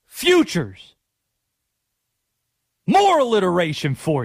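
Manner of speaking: punchy, emphatic football-coach voice; Gruden impression. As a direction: play a fired-up football coach hyping a tease. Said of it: "in the Gruden voice".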